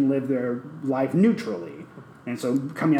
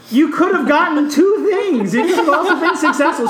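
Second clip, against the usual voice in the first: much higher and louder mock protest with a laugh; incredulous yell; 14 semitones higher, 7 dB louder than usual. To friends, retelling pleading with Hannah, laughing mock yell. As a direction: plead with her like it's absurd. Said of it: "And I was like".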